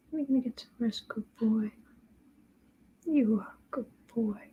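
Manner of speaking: quiet, hushed praise for the dog; soft pet soothing. Real, not acted. Owner hushing her dog to rest, soft murmur. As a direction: murmur him toward sleep.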